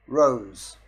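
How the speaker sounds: loud one-word order to a machine; voice command. Shown as a demonstration. Demonstrating voice control to viewers, loud command.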